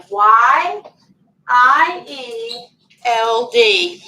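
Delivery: slow letter-by-letter spelling. Shown as a demonstration. Teacher spelling the word for children, slow. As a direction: space each letter out. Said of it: "spelling letter by letter".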